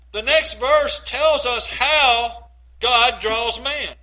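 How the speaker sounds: ordinary delivery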